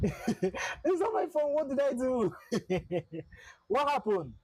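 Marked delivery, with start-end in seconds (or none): 0.0-2.3 high, slow, laughing "it's not my fault"; laughing protest. Real, not acted. Streamer laughing off his angry friend's blame, laughing. 2.4-3.2 short laugh. Real, not acted. Laughing at friend's blame.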